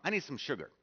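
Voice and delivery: blunt, pushy request; assertive demand. Retold or imitated. Jamaican neighbor demanding sugar from Holly, assertive. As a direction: demand the sugar like it's owed. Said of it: "kind of about that tone".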